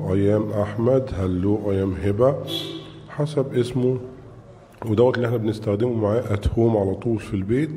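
ordinary delivery

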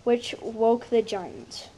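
usual speaking voice

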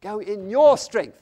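high, loud, drawn-out "your"; stress on YOUR. Retold or imitated. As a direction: punch "your" slowly and forcefully. Repeats line stressing your.

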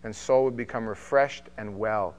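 measured, steady Bible reading; formal reading of scripture aloud. Acted. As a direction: read the passage with weight, slowing on key lines.

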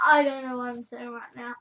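normal talking voice

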